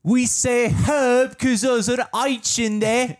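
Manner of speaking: high, loud, slow British caricature; mock British accent. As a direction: sound the H in "herb" as a shrieky fake Brit. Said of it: "That's how you guys talk".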